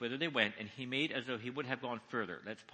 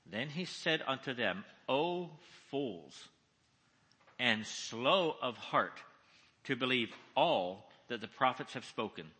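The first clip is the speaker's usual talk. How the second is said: scripture read slowly, leaning on key words; measured reading with weighty pauses between phrases; slow, deliberate, emphatic. Shown as a demonstration. Preacher reads Jesus's rebuke to the congregation, slowly and with emphasis. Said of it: "I'm gonna read slowly, deliberately, with emphasis".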